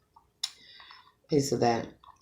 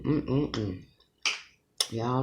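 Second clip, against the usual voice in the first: ordinary talk, nothing marked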